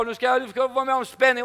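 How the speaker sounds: normal talking voice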